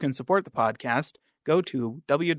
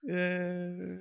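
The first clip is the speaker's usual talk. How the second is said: very soft, long, weary yay; unenthusiastic cheer; 5 semitones higher, 9 dB quieter than usual. Pretend. Co-host urged to be more enthusiastic, still half-hearted. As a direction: feebly cheer as if enthusiasm is spent. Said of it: "gotta be a little more enthusiastic".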